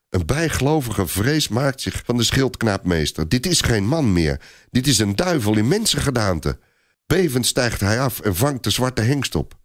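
plain reading voice

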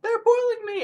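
very high, loud squealing voice; cartoon squeal of a boiling snack; panicked snack character. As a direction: shriek it like a tiny creature being cooked alive. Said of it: "He's like".

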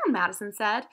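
usual speaking voice

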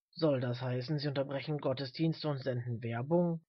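low-voiced, measured old man. A narrator's reading. Old man asking his companion about adverts, low voice.

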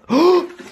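high frightened gasp; sharp gasp. Real, not acted. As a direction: gasp in shock. Sudden scare.

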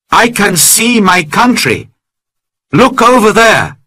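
thrilled to see his homeland; excited cry. Acted. Friday showing his master his country, excited. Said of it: "Friday cried".

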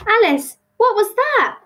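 high, loud, breathless question; startled and out of breath. A narrator's reading. Tilly turns to Alice after the journey, breathless. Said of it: "she said breathlessly".

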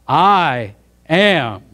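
I AM, each word drawn out loud; "I am" proclaimed slowly and forcefully; emphatic. Acted. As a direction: put heavy emphasis on both words of "I am", a beat between. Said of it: "He's putting emphasis on it".

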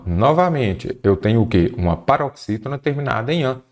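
usual speaking voice

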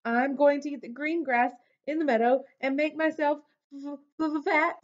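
high, quick, stammering on the last word; rushed high-pitched nervous stutter; shaking with fear. A narrator's reading. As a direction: answer as a frightened goat stuck on "fat". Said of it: "shook so hard he almost fell down".

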